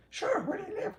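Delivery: cowgirl lady voice. Retold or imitated. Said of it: "She says".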